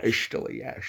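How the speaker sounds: soft Scottish slur; Sean Connery. Shown as a demonstration. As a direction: let it drop, slushy from the side of the mouth. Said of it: "Sean Connery is a little more like this".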